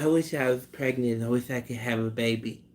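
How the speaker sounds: soft, hushed impression voice; Mike Tyson. Imagining the boxer wishing for a baby, soft impersonation. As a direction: say it softly as a comic celebrity impression. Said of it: "Imagine Mike Tyson saying".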